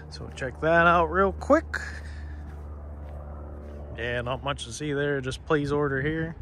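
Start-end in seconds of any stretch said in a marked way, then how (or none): none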